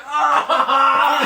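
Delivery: sudden cry of pain; pained yell. Retold or imitated. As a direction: yelp like a hurt man. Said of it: "He was like".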